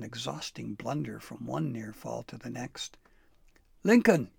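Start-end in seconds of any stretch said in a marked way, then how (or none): 3.8-4.3 loud high call into the woods. Acted. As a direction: shout the name across the forest. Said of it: "he called out".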